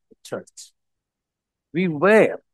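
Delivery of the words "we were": "were" set apart and leaned on; stress on WERE. Shown as a demonstration. Preacher highlights past tense for congregation, stress on "were". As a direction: underline "were" with your voice, then stop. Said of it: "underline the word were".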